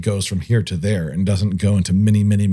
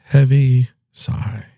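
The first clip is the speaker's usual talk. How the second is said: "Heavy sigh" stretched out slowly; slow, drawn out; 3 semitones higher, much slower than usual. Pretend. Repeating a verdict on the show, slow and drawn out. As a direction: draw out each word of "Heavy sigh".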